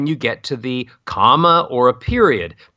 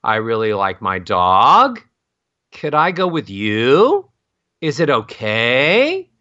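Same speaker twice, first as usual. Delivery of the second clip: slow, with a long swooping rise on "dog", "you", "okay"; scooping uptalk; 3 semitones lower, slower than usual. Shown as a demonstration. Voice coach mimics bad uptalk, scooping up on "dog", "you", "okay". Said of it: "You hear how I'm scooping up".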